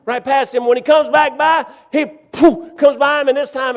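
high, loud excited narration; building storyteller shout. A narrator's reading. Preacher building the chase story to its climax, high loud shout. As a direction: belt the climax to the congregation.

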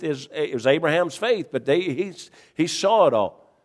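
rising, loud preaching build. Acted. Preaching builds to full force.

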